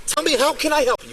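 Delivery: real heated yelling; angry shouting. Real, not acted. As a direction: shout over him, demanding an answer. Officer berates the detained man.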